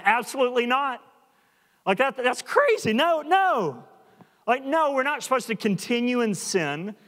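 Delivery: high-pitched, emphatic outright refusal; Paul, emphatic. Retold or imitated. Preacher voicing Paul's answer to a question on sin, emphatic. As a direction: reject the idea outright, pitch jumping up. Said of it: "this is really emphatic".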